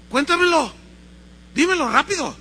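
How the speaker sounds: high, loud urging; acted exasperated confessor; impatient priest. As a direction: press the child to spit it out. Priest pressing the hesitant child.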